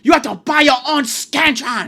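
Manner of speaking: high, very loud screamed demand; screaming teacher. Retold or imitated. Teacher orders the class to buy Scantrons, screaming. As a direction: scream it as a hammed-up teacher impression. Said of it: "She's screaming that".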